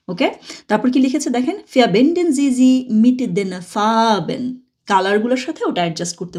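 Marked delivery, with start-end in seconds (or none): 1.6-4.5 very slow, clear German; slow model reading. Shown as a demonstration. Teacher reading the instruction for learners, slow. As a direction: say each word of "Verbinden Sie sie mit den Farben" apart. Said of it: "reads book instruction aloud".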